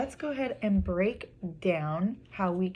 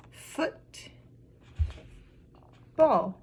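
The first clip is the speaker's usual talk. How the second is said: syllable by syllable; 4 semitones higher than usual. Shown as a demonstration. Teacher showing two syllables, broken apart. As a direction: hold a long gap between "Foot" and "ball". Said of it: "We heard".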